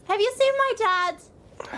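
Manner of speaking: high, drawn-out worried plea; anxious plea. Acted. Player's character asks after a missing dad, anxious plea.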